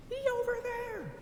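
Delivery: squeaky high tattletale; high mock Karen voice. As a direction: pitch way up, mocking her. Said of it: "Karen outs him talking about some".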